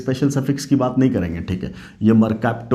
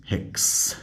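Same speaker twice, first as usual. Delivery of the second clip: whisper; 8 dB quieter, slower than usual. Acted. Teacher whispering the answer aside.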